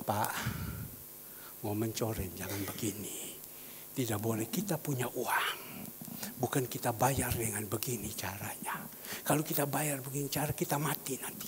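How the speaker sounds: hushed retold warning; quiet admonition. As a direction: counsel him gently and discreetly. Retelling his cautioning of the boss.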